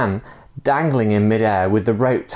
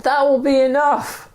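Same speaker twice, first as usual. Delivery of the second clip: high, steady urging; calm insistence. Acted. Stranger urging from the rope, high insistent voice. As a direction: keep him going. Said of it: "the man said".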